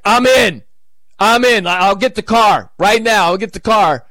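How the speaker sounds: man jumping at a hot invitation, overeager; eager. Retold or imitated. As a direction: jump at the offer. Eager reply to tempting invitation.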